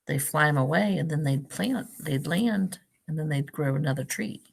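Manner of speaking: plain reading voice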